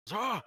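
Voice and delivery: sudden high yelp of fright; startled scream. Acted. Server jumped from behind, startled high scream. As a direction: shriek as someone leaps at her.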